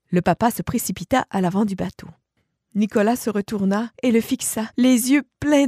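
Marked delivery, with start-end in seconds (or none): none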